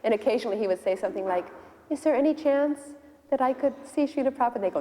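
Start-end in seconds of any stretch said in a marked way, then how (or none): 1.9-4.5 higher-pitched, hopeful request; polite plea. Retold or imitated. Visitor at the door asking devotees to see Prabhupada, humbly pleading. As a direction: ask humbly, voice lifted.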